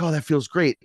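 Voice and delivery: high, loud sigh of relief; like a weight just lifted off; blissful relief. Retold or imitated. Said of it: "And you're like".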